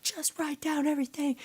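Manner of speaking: high-pitched mock yell at a friend. Venting at a friend in her head, mock yelling. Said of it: "in my mind yelling at".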